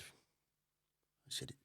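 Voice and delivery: ordinary delivery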